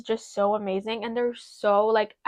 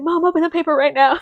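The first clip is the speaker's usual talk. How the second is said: higher, louder urgent demand to mom; urgent excitement; 6 semitones higher, 7 dB louder than usual. Retold or imitated. Daughter on the phone to mom about the letter, urgently excited. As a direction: re-enact an excited plea, raised in pitch and volume.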